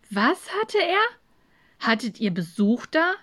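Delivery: high-pitched puzzled question, then settling; taken aback. Acted. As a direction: ask it as a baffled kid. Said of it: "Samu schaute verdutzt".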